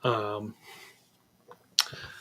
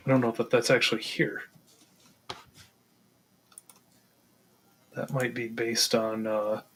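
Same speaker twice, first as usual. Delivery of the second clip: halting and unsure, slowed by long pauses; real hesitation, feeling for the answer; hesitant stammering; 3 dB quieter than usual. Real, not acted. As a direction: think aloud haltingly, second-guessing yourself. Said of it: "I'm stammering because I'm second guessing myself".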